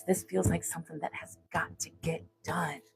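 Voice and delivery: hushed, breathy whisper. Real, not acted. Confides to Sagittarius in a whisper. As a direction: lean in and whisper it.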